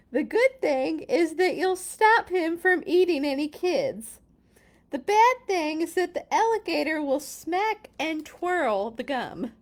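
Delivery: loud, high, animated read-aloud; dramatic storybook narration. A narrator's reading. Reader telling children about alligator's gum, loud animated narration. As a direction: punch the good news and the bad. Reads picture book aloud.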